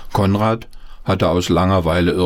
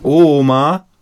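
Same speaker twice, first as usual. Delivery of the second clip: put-on child calling Oma, higher and louder; 7 semitones higher, 6 dB louder than usual. A narrator's reading. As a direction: call her from the bedroom. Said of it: "rief Paul". (Paul ruft laut nach der Oma)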